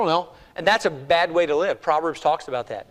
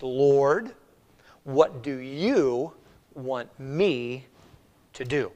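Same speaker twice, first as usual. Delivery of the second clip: slow prayer; 3 semitones lower, much slower than usual. Shown as a demonstration. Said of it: "Let's read it a little slower".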